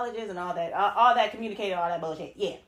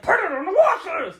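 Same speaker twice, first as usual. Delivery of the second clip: high, loud comedic catchphrase; loud, high-pitched comedy bit; Courtney Taylor; 10 semitones higher, 9 dB louder than usual. Retold or imitated. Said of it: "especially her series when she said".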